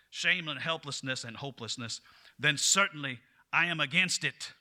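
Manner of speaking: booming Southern politician's oratory; Southern accent. Retold or imitated. Addressing the Mississippi legislature in a Southern accent. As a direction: orate like a fiery Mississippi legislator. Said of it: "I can't stop myself from doing a Southern accent".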